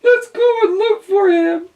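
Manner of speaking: very high tearful cat voice; crying cat. A narrator's reading. Cat crying over missing duck, tearful. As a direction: sob it out as the cat.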